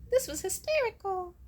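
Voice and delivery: squeaky dismissive imitation; mock airhead friend. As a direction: parody a clueless laughing reply. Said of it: "they'll be like".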